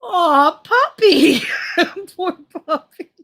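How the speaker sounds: high, slow, laughing coo over the poor puppy; cooing over a dog, laughing; laughing pity. Real, not acted. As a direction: aww it out, cracking up. Pitying the dog while laughing.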